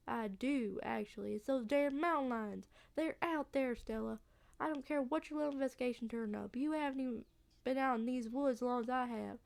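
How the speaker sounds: insistent Southern country voice; worked-up local with a Southern accent. Acted. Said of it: "a southern accent".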